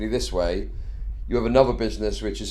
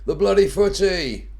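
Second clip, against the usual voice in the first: rowdy football lad; 8 semitones higher, 4 dB louder, slower than usual. Pretend. Lad hollers about football again.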